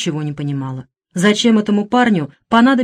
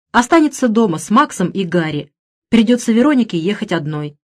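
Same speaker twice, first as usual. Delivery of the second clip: usual speaking voice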